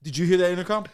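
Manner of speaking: high, loud imitation of agitated husband; mock insistent question. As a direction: demand an answer from the wife. Acted insistent question to wife.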